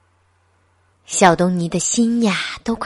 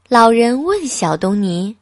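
usual speaking voice

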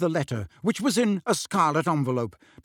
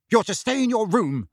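high, firm order; brisk, snappish; 6 semitones higher than usual. A narrator's reading. Aunt turning on Harry, brisk snappish order. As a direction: lay down the rule crisply. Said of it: "regaining her usual brisk, snappish manner".

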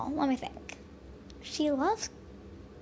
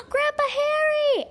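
high, slow joyful shout of his name; excited shout; 6 dB louder than usual. Acted. Girl runs to greet her grandpa, shouting joyfully. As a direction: greet him with a big happy yell. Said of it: "I shouted".

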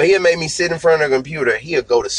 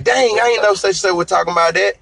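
higher-pitched imitation of surprised listeners; mock surprised realization; 8 semitones higher, faster than usual. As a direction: act out someone suddenly catching on. Acting out listeners' belated realization.

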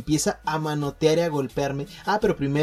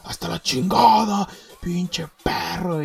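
higher, heated furious threat; furious friend; 4 semitones higher than usual. Retold or imitated. Restrained friend keeps threatening narrator, higher and furious. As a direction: snarl threats while being held back.

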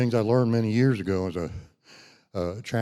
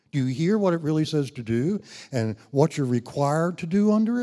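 rising pitch, louder; building preaching; 6 semitones higher, 3 dB louder than usual. Acted. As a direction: drive the question home.

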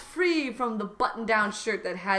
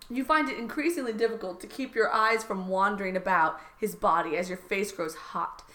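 usual speaking voice

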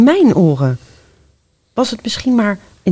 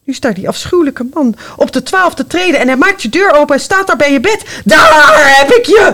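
building to shout; 6 semitones higher, 5 dB louder than usual. Acted. Ghost story climax.

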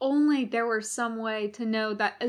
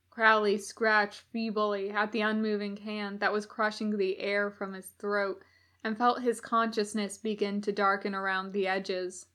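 plain reading voice

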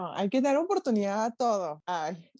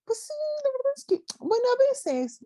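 imitating the date. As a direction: drag it out high like a lazy guy. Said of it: "Algo tienes que hacer de tu vida".

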